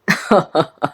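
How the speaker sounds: acted villainous laugh; villain's laugh. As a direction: laugh at a captive's begging. Captor laughs at her pleas.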